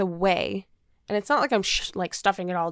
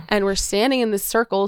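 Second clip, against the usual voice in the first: ordinary talk, nothing marked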